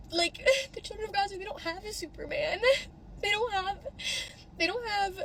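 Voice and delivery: choking up as she speaks; tearful, breaking voice; real crying. Real, not acted. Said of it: "I'm just getting so fucking emotional".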